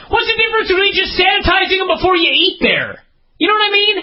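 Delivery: shouted high, fed up with his own plan; exasperated outburst. Real, not acted. Exasperated rant about the counters.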